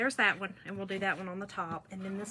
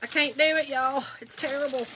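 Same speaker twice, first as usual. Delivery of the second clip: louder, exasperated cry of defeat; loud frustration; 4 semitones higher, 8 dB louder than usual. Real, not acted. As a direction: throw up your hands and declare it hopeless out loud. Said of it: "Bear with me".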